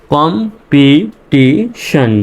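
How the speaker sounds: কম্পিটিশন stretched slowly, শন ending drawn out; slow teacher read of কম্পিটিশন; slow syllable demo. Shown as a demonstration. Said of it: "শান উচ্চারণ হবে".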